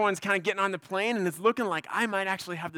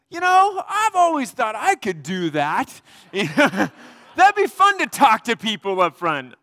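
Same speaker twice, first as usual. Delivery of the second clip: loud tipsy boast; 3 semitones higher, 10 dB louder than usual. Retold or imitated. Drunk seatmate telling the pastor he could preach, loud and laughing.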